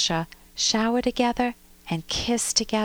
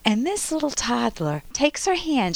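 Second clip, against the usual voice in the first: ordinary delivery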